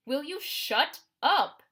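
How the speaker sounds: loud, high, slow outburst. A narrator's reading. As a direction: blurt it out too loud. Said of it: "It came out louder than I meant to".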